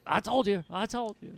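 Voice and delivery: high, insistent announcer's outcry; high mock gloating; Bobby Heenan. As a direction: imitate a vindicated heel commentator. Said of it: "like, Bobby Heenan did it".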